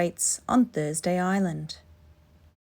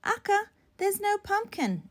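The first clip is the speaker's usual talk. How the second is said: put-on child voice; 13 semitones higher, 4 dB quieter than usual. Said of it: "answered Betty".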